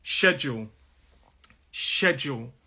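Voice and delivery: "schedule" as SHED-jool, two syllables; isolated, careful British "schedule"; model pronunciation. Shown as a demonstration. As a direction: say "schedule" starting with sh, falling at the end. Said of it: "the pronunciation of this word is".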